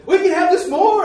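high, loud, thrilled exclamation; excited amazement. Retold or imitated. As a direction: imitate a man overjoyed at the news. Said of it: "he was real excited".

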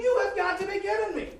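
high, loud, laughing cry of disbelief; a near-squeal of laughing exasperation; reliving it with a laughing shout. Retold or imitated. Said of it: "I say".

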